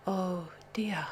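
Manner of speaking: soft, whispery guilty murmur; sheepish. A narrator's reading. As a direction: admit it softly, caught out. Rollo caught having eaten everything.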